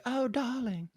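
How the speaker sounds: high, soft posh-sounding "darling"; a light fifties film star drawl; transatlantic accent. Retold or imitated. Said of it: "they were like".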